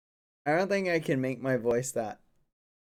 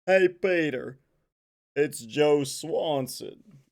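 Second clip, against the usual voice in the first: hearty call, raised pitch, stretched out; Joe Swanson; 2 semitones higher, 4 dB louder, slower than usual. Acted. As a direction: greet Peter in a put-on cartoon voice. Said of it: "Family Guy characters".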